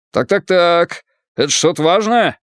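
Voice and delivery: put-on a nosy old man with an Irish accent. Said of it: "Ирландский акцент работал на всю катушку".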